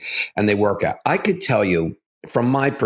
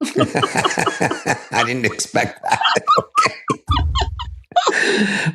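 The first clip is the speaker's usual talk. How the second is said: real laughter; 11 semitones higher, much slower than usual. Real, not acted. Host surprised by guest's quip about a chilly room, laughing. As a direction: caught off guard, laughing through the words. Caught off guard by guest's joke.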